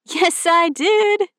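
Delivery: high, slow sing-song tease; playful drawn-out dad. Acted. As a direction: stretch it out playfully.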